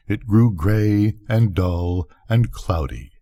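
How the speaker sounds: ordinary delivery, nothing marked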